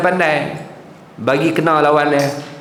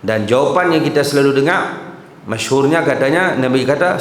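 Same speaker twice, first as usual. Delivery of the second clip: ordinary talk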